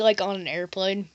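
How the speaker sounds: ordinary talk, nothing marked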